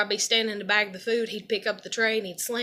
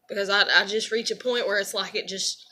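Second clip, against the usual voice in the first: usual speaking voice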